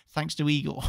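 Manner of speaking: ordinary delivery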